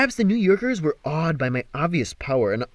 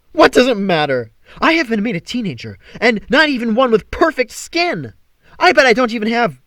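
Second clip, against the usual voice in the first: rising panic; 8 semitones higher, 7 dB louder than usual. Acted. Apollo panicking over his body.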